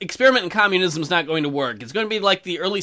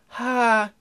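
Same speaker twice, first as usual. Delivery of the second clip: single stilted "ha"; mock laugh; 3 semitones higher than usual. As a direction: fake one laugh as a deliberate word. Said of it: "I laughed aloud even".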